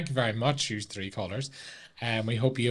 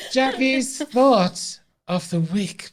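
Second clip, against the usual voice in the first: announcer voice; 11 semitones higher, 5 dB louder, much slower than usual. Acted. Announcing the weekly segment.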